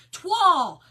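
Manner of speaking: "Twal" said like French toile, "twahl"; loud, falling "Twal" with a "wah" vowel; corrected pronunciation. Shown as a demonstration. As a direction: announce the fix: "Twal". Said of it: "Twal, Susan".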